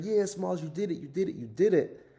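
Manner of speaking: very high, loud cheer; cheering kids. Retold or imitated. Teammates cheering Smalls' home run, high and loud. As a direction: cheer him on after the homer.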